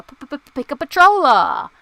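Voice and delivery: goofy mock announcer shout; playful stuttering call-out. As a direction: belt it out after popping the P's. Playfully repeats own question, exaggerated.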